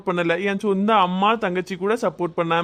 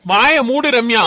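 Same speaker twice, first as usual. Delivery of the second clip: louder, firm command to shut up; stern order; 4 semitones higher, 6 dB louder, slower than usual. Acted. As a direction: cut her off sharply. Husband silences arguing wife.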